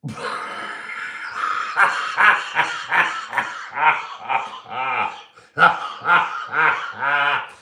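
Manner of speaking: high, loud burst of laughter that won't stop; howling with real laughter; long helpless laugh. Real, not acted. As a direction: crack up completely at the comment. Long fit of laughter at comment.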